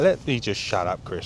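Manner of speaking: normal talking voice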